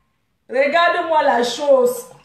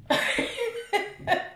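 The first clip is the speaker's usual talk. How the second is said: mocking laugh; 9 semitones higher, 8 dB quieter than usual. Real, not acted. Laughs at her rival.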